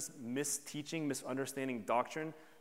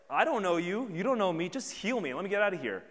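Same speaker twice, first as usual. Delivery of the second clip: loud, high, wounded protest; imagined indignant rant; 6 semitones higher, 8 dB louder than usual. Retold or imitated. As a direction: push back hard, offended. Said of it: "He could have been like".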